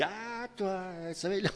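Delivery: a hint of a girl pointing someone out; mock accusing servant. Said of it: "la petite servante là qui dit".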